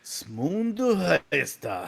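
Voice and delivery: very slow fake Japanese gibberish; badly faked Japanese; mock Japanese. Showing off fake Japanese to his cohost. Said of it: "Your Japanese accent's horrible".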